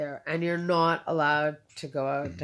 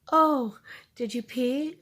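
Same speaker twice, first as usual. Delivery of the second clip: higher, louder, drawn-out mock astonishment; imitated surprise; 7 semitones higher, 5 dB louder, slower than usual. Man finding the patient wet her room, imitated surprise. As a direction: act out someone's slow, high-pitched discovery.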